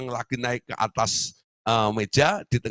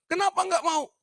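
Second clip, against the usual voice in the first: doctor asking. Retold or imitated. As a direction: ask why he won't come down.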